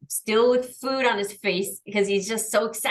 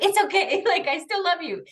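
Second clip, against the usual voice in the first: high, sweet reassuring pet voice; soothing the dog; 11 semitones higher than usual. Retold or imitated. Owner forgiving Luna right after yelling, high and cooing. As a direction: make up with the puppy, high and gentle. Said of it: "immediately, I'm like".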